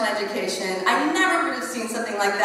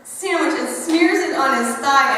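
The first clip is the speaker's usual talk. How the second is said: usual speaking voice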